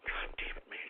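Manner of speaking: whispered curse under the breath; exasperated whisper. Real, not acted. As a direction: hiss it to yourself, fed up. Exasperated on-air rant.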